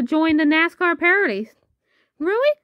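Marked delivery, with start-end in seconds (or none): 2.2-2.6 high, thrilled yelp of Really; an overjoyed pup can't believe it; high puppy squeal of joy; excited puppy. Acted.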